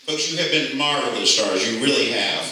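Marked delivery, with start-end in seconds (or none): none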